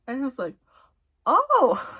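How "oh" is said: very high, loud retold surprise; surprised yelp. She learns it's a boy, high surprised reaction. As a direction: let it jump right up in astonishment.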